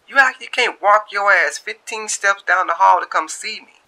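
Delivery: high, loud, playful scold; teasing Shantae. Acted. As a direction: call him out for being lazy.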